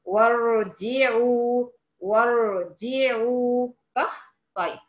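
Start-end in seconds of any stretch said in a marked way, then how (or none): 0.1-3.7 وارجعوا drawn out as "war-ji'uu", no hamza; linked, hamza dropped. Shown as a demonstration. Teacher adds waw before the word, hamza dropped. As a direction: slowly join wa straight onto the r in وارجعوا. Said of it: "لو أنا هحط قبلها حرف الواو هقول إيه؟".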